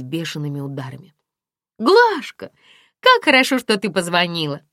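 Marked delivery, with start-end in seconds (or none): 1.8-4.6 high, loud delighted greeting; ringing with joy. A narrator's reading. Friend greets Aglaya on the phone, ringing joy. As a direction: let the voice ring out happily. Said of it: "Голос подруги звенел от радости".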